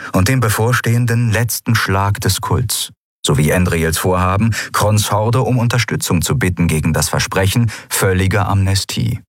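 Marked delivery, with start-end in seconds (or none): none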